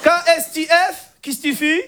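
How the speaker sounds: high mock Moroccan accent. Cousin typing chat shorthand, thick Moroccan accent. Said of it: "l'accent arabe".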